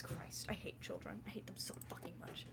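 whispered frustrated grumble; whispering. Real, not acted. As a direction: hiss it under your breath.

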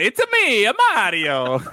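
playful Italian accent impression; fake Italian accent. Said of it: "the fake Italian voice".

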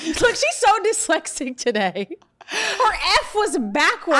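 slow, laughing mockery; laughing tease. Real, not acted. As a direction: tease her while laughing. Laughing teasing friend.

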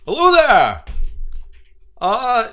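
high, loud hearty greeting; bright harbor master hailing the ship; cheery harbor master. Acted. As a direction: boom out a friendly dockside welcome.

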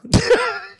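squealing burst of laughter; high-pitched laugh. Real, not acted. High laugh after mock quote.

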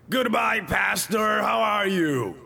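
put-on high, loud little boy with a Cibao accent; mock Cibaeño accent, pitched well up. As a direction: imitate a boy calling out in a thick Cibao accent. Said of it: "Talking to me with a typical accent".

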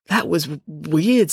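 hesitant stutter on W; halting, puzzled remark; stammer. A narrator's reading. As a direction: get stuck, then push the word out. Joe's stammer voiced in story.

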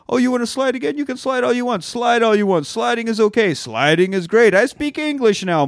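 high, eager voice of the bribed Italian owner; delighted Italian owner. Retold or imitated. Italian owner suddenly friendly.

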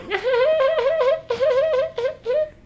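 very high-pitched mock squealing; drawn-out high mimicked squeal; imitated girly noise. Teller imitates San Diego girls doing their thing, high squeal.

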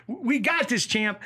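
high-pitched, rallying cheer; acted pep talk. Chicky answering the boxer's jabs, acted pep talk. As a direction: fire up the champ. Acting his line after the jabs.

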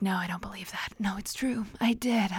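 hushed, breathy whisper of a doubter; whispering the people who doubted her; whispered quote. Retold or imitated. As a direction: whisper it like a disbelieving voice. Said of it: "a lot of people would be like".

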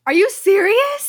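loud, high incredulous question; exasperated disbelief. Real, not acted. Friend objecting as an old fight comes up, exasperated.

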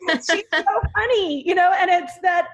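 fond laughing praise; laughing fondly. Real, not acted. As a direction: gush through leftover laughter. Laughing fondly about character.

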